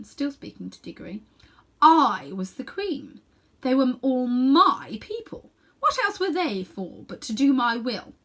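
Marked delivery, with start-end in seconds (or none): 1.8-8.1 slow, loud, regal declaration; proud queen. A narrator's reading. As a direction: proclaim it like a haughty queen. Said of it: "said the Queen".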